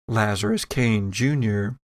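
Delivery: ordinary talk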